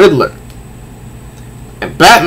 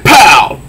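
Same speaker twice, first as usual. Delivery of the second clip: punchy comic-book pow; 6 semitones higher, 3 dB louder than usual. Acted. Narrator punching out the sound effect, high and sharp. Reading comic fight aloud.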